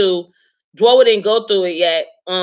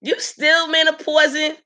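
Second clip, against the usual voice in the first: mock question; 6 semitones higher than usual. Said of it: "people be like".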